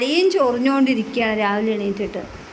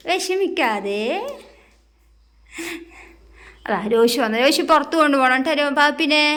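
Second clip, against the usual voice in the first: put-on higher, sing-song voice soothing a crying toddler; coaxing baby talk; 5 semitones higher than usual. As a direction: coax the little one to stop crying.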